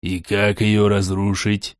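low, slow irritated question. Acted. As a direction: ask it low with annoyance. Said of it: "раздражённо спросил Борей".

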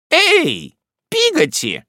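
high, loud call down the hall. A narrator's reading. Miss Betsey calling the maid through the door, loud. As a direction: summon the maid sharply. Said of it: "крикнула мисс Бетси".

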